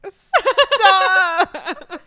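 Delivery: loud high laughing stop; laughing shout. Real, not acted. Embarrassed by teasing.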